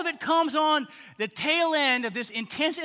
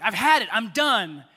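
loud, exasperated declaration; imitated end-of-patience outburst; fed up; 7 dB louder than usual. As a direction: act out being finished with them, punched hard. God voiced, fed up.

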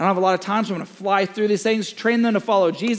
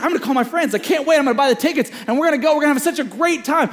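higher and louder, gushing with excitement; eager teen voice, louder and higher; excited kid; 8 semitones higher, 4 dB louder, much faster than usual. Retold or imitated. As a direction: rattle it off like a thrilled teenager. Son thrilled about the movie.

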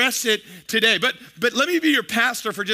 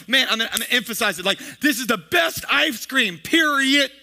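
excited slang demo; 3 semitones higher than usual. Shown as a demonstration. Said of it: "So you just go".

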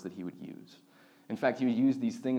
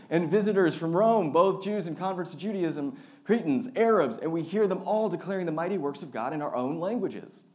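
higher, louder projected reading; scripture reading; 5 semitones higher, 4 dB louder than usual. Acted. Pastor reads the list of nations to the congregation, raised reading voice. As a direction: proclaim the passage, settling toward the end.

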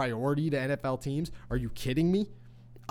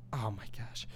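whispered under the breath in disbelief; exasperated whisper; 5 semitones lower, 12 dB quieter than usual. Real, not acted. Host whispering in disbelief at the trade demand. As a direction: let it slip out as a whisper.

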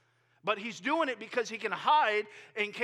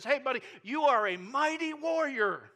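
higher, louder, breezy "hey buddy" greeting; cheery messenger; 6 semitones higher, 7 dB louder than usual. Acted. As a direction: hail the coward like a hero with a grin. Messenger greeting hiding Gideon.

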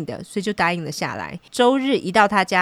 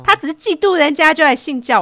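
higher, louder, disbelieving; incredulous outburst; 9 semitones higher, 7 dB louder than usual. Real, not acted. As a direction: blurt it out in disbelief.

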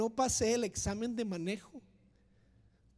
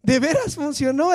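loud, much higher astonished cry; amazed; 6 semitones higher, 11 dB louder, slower than usual. Retold or imitated. Finding zero wrong answers, a loud amazed cry. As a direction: burst out in disbelief. Passing the exam.

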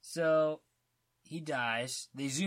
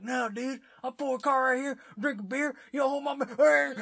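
high, blustering mock civilian voice; wannabe Rambo; 12 semitones higher, 6 dB louder than usual. Civilian arguing back, high and garbled. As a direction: ramble high and excited, dissolving into garble. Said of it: "thinks he's Rambo".

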